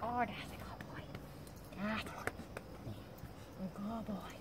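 soft whisper to settle the dog; whispered praise. Real, not acted. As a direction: hush it to a calm puppy. Soothing the puppy.

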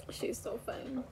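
breathless whispered giggle; whispered laughter. Real, not acted. Mom whispering through laughter about her funny daughter. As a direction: laugh under your breath. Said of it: "laughing so hard".

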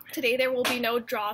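usual speaking voice

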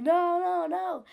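high, wailing no no no; retold crying no. A hurt child wails at the teacher, high and tearful. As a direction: plead through tears like a hurt child. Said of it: "I'm screaming and crying".

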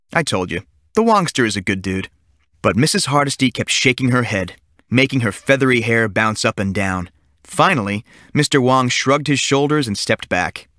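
plain reading voice

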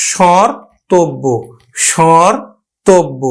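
স্বরতব্য drawn out slowly in two parts, shor-tobbo; pronunciation demo. Shown as a demonstration. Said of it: "খুব সহজেই কিন্তু আমরা উচ্চারণ করতে পারলাম". (স্বরতব্য ধীরে ভেঙে উচ্চারণ)

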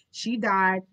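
usual speaking voice

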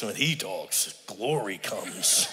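mock the big deep voice, slowly; admiring impression of a deep-voiced pastor; deep preacher voice. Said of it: "I got that little whiny sounding voice".

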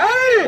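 high drawn-out "hey" cry; urging yell. Real, not acted. Commentator urging on racing bulls, high yell.

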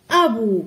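أبو with a firm, loud glottal onset on the first a; hamza pronounced. Shown as a demonstration. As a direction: start أبو on a clear glottal stop. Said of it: "ما يمكنناش نطقوها بدون همزة".

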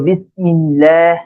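বিসমিল্লাহ very slowly, syllable by syllable; pronunciation demo. Shown as a demonstration. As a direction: draw out the doubled l and long "laah" in বিসমিল্লাহ. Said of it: "এইভাবে উচ্চারণ করার চেষ্টা".

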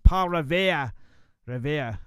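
a lousy Boston accent, r dropped in "Revere" as "Reveah"; a self-mocking Boston accent on "Paul Revere", "Paul" with a broad open a. Shown as a demonstration. Radio host playfully doing a Boston accent for listeners, lousy Boston accent. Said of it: "I do a lousy Boston accent".

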